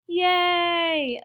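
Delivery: loud, high, long yay; cheering. A narrator's reading. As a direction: cheer as one relieved crowd. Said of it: "the crowd cheered".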